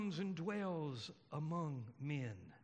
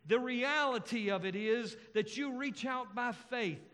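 louder, higher, emphatic sermon delivery; impassioned preaching; 5 semitones higher, 4 dB louder than usual. Real, not acted. Preacher declares a touchable Jesus to congregation, impassioned preaching. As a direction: preach it with weight, drawing out the key phrases.

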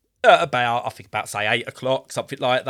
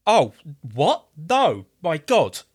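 stunned surprise; 5 semitones higher, slower than usual. Retold or imitated. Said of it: "all I hear is".

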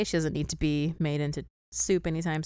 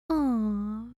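tiny, higher drawn-out "aw"; cute little voice; 4 semitones higher than usual. Retold or imitated. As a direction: do the Tin Woodsman's sweet little coo. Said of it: "such a cute little voice".

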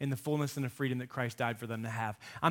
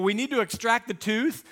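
put-on higher, louder, frightened child's echo; girl hearing it; 8 semitones higher, 6 dB louder than usual. Daughter on the dentist table, scared echo. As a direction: repeat it as the scared girl would hear it. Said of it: "this little girl laying on this table".

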